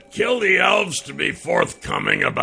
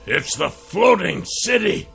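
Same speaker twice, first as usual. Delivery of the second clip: higher exclaiming cry; cried out; 9 semitones higher than usual. Acted. Dwarf crying out on seeing the city, higher. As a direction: call it out in alarm. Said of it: "Brenoir cried out".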